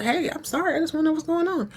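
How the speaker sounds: lightly acted wife, pitched high; placating wife. Voices wife soothing snapping husband.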